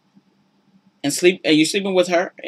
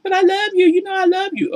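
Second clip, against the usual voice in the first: high, syrupy mock I love you; mock loving man; 13 semitones higher, 3 dB louder than usual. Cheating man sweet-talking her, mock syrupy high. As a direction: coo it falsely. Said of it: "he's just like".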